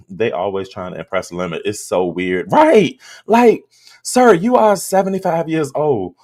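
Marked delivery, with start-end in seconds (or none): 2.5-6.1 exasperated scolding. Real, not acted. Exasperated rant at Lemon.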